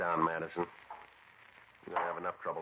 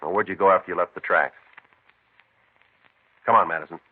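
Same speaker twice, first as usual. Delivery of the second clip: much louder, hard and commanding; stern pressure; 14 dB louder than usual. Acted. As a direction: lean on him with force. Cop pressing the suspect.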